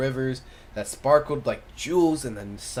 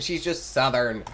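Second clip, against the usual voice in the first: usual speaking voice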